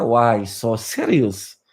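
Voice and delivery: low, very drawn-out villain line; slow it right down, low and teasing; Joker. Acted. Coach quoting a film villain to lighten up, low slow villain impression. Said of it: "Makanya kata Joker".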